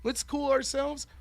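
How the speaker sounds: slow, soothing mock appeal; mock calming. Judge tries to settle the heated room, slow calming. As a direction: slowly talk them down.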